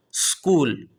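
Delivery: اسکول said skool, starting right on the s; اسکول as English skool; correct skool again. Shown as a demonstration. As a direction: clean skool, no vowel in front. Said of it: "شروع میں سین کی آواز نکل رہی ہے".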